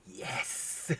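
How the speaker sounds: hushed, whispered yes; secret glee. Acted. Husband secretly willing wife to die, gleeful whisper. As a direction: whisper a private little victory. Secretly rooting for her death.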